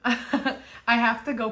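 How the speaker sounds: light laugh running through the words; chuckling, a bit sheepish. Real, not acted. Laughs, unsure of next patterns.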